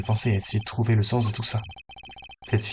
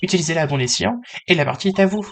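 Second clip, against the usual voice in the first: much higher put-on voice; high-pitched character voice; 8 semitones higher than usual. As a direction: play the card dealer up in a high register. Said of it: "la voix aiguë".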